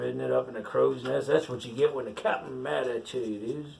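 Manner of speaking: ordinary delivery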